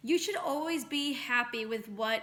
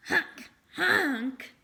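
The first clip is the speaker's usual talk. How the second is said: comic snot-blowing honks; nose-blowing honks; 3 semitones higher, 5 dB louder than usual. Acted. Boy blows his nose.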